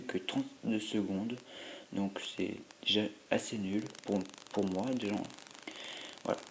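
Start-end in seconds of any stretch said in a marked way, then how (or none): none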